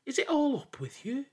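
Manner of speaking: high grieving question; sad plea. A narrator's reading. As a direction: beg a lifeless friend to answer. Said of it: "sad in his heart".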